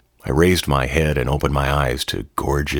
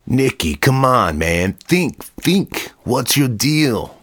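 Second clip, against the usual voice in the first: much higher, exasperated pushing; pressing bully; 9 semitones higher, slower than usual. Acted. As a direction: goad him to think, slowing at the end. Marlon pressing the drunk narrator.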